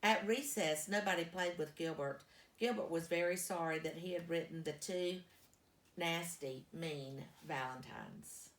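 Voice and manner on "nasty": ordinary delivery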